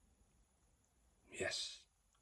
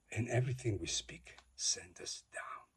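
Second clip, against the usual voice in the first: ordinary talk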